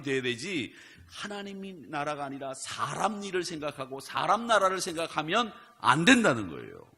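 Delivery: loud, high, impassioned sermon climax; full-force preaching. Acted. Pastor urging believers to think of God's work, fervent loud preaching.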